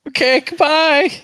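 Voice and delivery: high, loud, drawn-out goodbye; mock panicked farewell. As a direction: stretch out a panicky exit. Joining in the overwhelmed exit.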